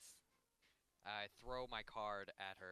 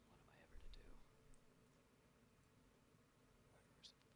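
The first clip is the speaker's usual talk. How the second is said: hushed, brooding detective whisper; whispered noir monologue; 5 semitones lower, 18 dB quieter than usual. Acted. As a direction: whisper it like a weary gumshoe.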